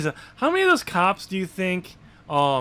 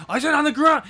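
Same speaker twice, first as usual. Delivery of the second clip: higher, louder repeated order; cop shouting again; 12 semitones higher, 6 dB louder than usual. Retold or imitated. Cop repeats his order to the suspect. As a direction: yell it like a cop losing patience. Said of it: "he's like".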